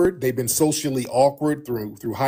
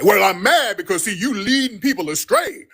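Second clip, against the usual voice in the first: higher, whiny accusing caller; mock indignant caller, pitched up; mocking an angry caller; 7 semitones higher than usual. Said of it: "what's with all of this weird energy".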